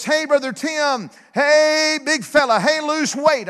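high, teasing call from above; mock audible voice. Preacher mimics a voice teasing him about weight, high calling voice. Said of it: "I'm not talking about a voice".

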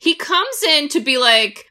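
normal talking voice

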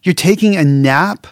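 mock-indignant disbelief; exasperated. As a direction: throw it at him, fed up and incredulous. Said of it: "I would have been pissed".

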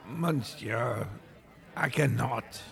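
dramatic French refusal; French waiter. Acted. Pettiford refuses dessert.

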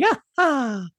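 Mario cheer. Pretend. Mario cheer after title.

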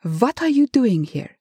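put-on high, accusing German-accented questions; angry, indignant. A narrator's reading. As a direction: demand an explanation in angry disbelief. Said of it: "he hissed. He was angry. He was furious".

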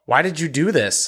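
ordinary delivery, nothing marked

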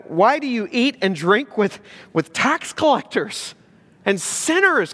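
incredulous, voice climbing on the offending words; scandalized Pharisee. Acted. Pharisees challenging disciples over the company they keep, scandalized. Pharisees' shocked question acted out.

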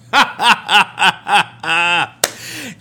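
loud, staccato belly laugh. Real, not acted. Preacher laughing mid-sermon at the idea of being worthy, hearty laugh. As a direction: burst into rhythmic ha-ha laughter.

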